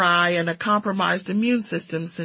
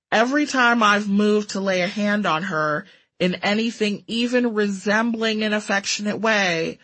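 plain reading voice

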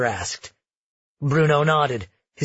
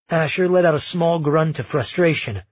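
ordinary delivery

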